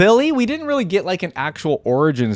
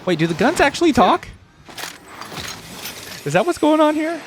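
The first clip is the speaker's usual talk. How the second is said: surprised question; 7 semitones higher than usual. Real, not acted. Surprised at talking guns.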